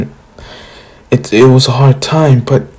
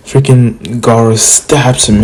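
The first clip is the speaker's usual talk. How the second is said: ordinary talk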